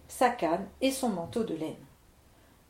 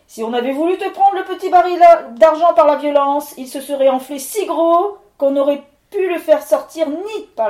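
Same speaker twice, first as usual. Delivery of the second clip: high, much louder raging accusation; furious spirit; 5 semitones higher, 10 dB louder than usual. Acted. Levent calling the miller a liar, furious loud spirit. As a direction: thunder out the threat.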